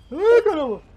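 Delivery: high loud startled ai; startled cry. Real, not acted. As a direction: yelp in alarm. Hit by danger.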